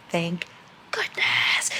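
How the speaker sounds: whispered relief. Real, not acted. Relieved reader at night, whispering. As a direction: breathe the word out. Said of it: "I can't talk that loud".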